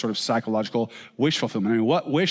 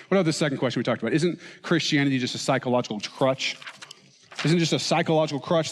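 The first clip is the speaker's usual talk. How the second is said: ordinary talk, nothing marked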